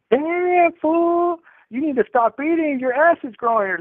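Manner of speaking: high, loud mock tease; joking to a best friend about his weight, high and teasing; teasing joke voice. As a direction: rib a buddy in a silly squeaky voice.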